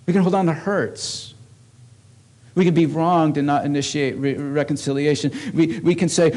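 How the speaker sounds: loud, high, driving sermon voice; preaching at full force. Acted. Pastor preaching hard to his congregation, loud and driving.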